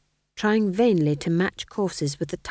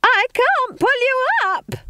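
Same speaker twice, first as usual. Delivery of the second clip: very high, loud, drawn-out scream; 6 dB louder, slower than usual. A narrator's reading. Witch dangling friend at arm's length, screamed. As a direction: scream it at the top of the voice. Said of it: "stared into one another's faces and screamed".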